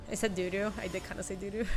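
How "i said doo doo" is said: ordinary talk, nothing marked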